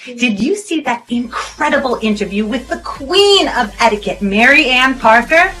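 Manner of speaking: promo ad read. Acted. Host plugging a free book giveaway to viewers, hyped promo read. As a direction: sell it loud and excited, hitting key words hard.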